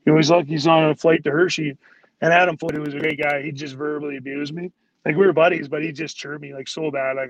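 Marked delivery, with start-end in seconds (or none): none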